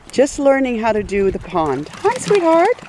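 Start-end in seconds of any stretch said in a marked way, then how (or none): 2.0-2.8 high, sweet coo to a puppy; much higher sing-song puppy talk. Real, not acted. Greeting puppy at the pond.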